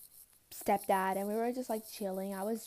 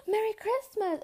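excited greeting; 11 semitones higher than usual. Retold or imitated. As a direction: cheer it out like a star. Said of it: "[name] was just goes".

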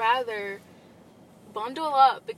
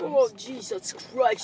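slow exasperated groan; slower than usual. Pretend. Drawn-out exclamation again.

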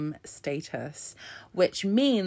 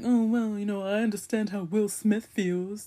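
mocking husband voice; 5 semitones higher than usual. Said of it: "he was like".

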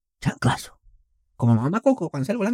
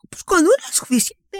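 much higher mock mother; mocking mom. Son imitating mom's Xbox reply, very high. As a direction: chirp the line in a mom voice. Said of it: "Así".

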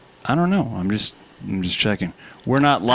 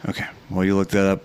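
ordinary delivery

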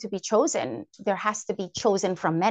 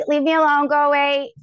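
louder, snappy leave-me-alone; mock yell at brother; 3 semitones higher, 5 dB louder than usual. Teen to little brother at the door, loud mock yell. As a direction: shoo a pestering little brother away, loud. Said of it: "And I'm like".

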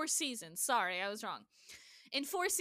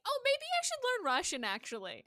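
mock sudden idea; 10 semitones higher than usual. Host imagining the character deciding to study a language, mock sudden idea. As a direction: put on a light, higher voice for a casual realization.

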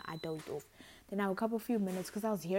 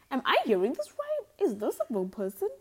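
amazed; 7 semitones higher, 9 dB louder than usual. Retold or imitated. Imitated listener amazement.

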